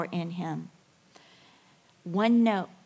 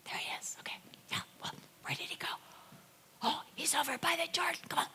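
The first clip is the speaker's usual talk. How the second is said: whispered, creeping after Jesus; sneaky whisper; 7 semitones higher, 13 dB quieter, faster than usual. Retold or imitated. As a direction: hush it like you're sneaking up. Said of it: "they're like".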